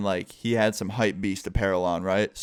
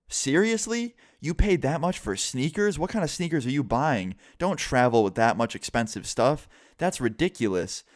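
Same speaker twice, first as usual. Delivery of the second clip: mock disbelief, high at first and easing down; incredulous scolding; 5 semitones higher than usual. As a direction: imitate a clerk belittling a customer's purchase. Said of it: "basically just calling me stupid".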